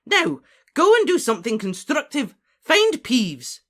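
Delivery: high, loud scolding command; loud, impatient professor; exasperated order. Acted.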